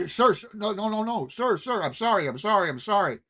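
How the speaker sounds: high, hurried, grovelling pleas; a hint of a frightened casino owner backing down; panicked apology. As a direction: beg forgiveness in a fluster.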